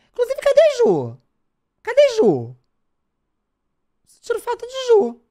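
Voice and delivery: high, loud call to chat. Real, not acted. Streamer calling for Ju in chat, high loud call. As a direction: shout for Ju like calling across the room.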